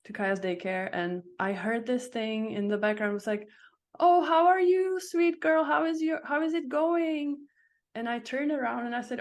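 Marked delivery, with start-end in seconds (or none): 4.0-7.4 high, louder, gushing preschool-teacher greeting; sweet teacher voice. Retold or imitated. Daycare teacher greeting a toddler in the bathroom, high and sweet. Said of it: "with her teacher voice".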